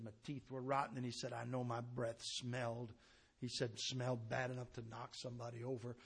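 low, soft testimony telling; subdued pulpit story, gradually gaining a little volume; hushed sermon storytelling. Real, not acted. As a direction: draw the congregation in quietly.

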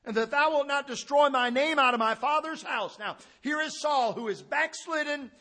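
loud, high preaching at full force; fiery preaching. Acted. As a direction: thunder it from the pulpit, high and loud. Preaching Saul's plea at full force.

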